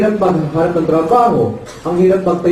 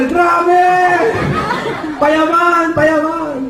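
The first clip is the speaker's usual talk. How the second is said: euphoric shouting; 11 semitones higher, much slower than usual. Retold or imitated. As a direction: cheer like the seminar crowd.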